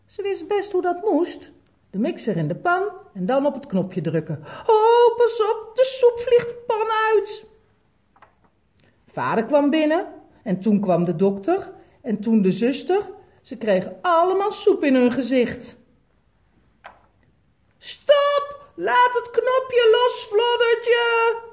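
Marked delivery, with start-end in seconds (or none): 4.4-7.5 high, loud panicked cry; alarmed cry. Acted. Child sees soup flying everywhere. 17.8-21.5 put-on high, loud shout at the child; shouting stop. Someone yells to stop the mixer.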